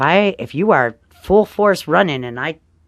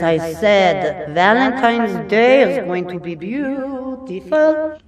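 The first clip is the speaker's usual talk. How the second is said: higher and slow, stretching every word grandly; playful drawn-out announcement; 5 semitones higher, slower than usual. Pretend. Astrologer teasing her viewers, slow and grandly playful. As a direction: ham it up like a big proclamation. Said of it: "I just had to play around for a moment".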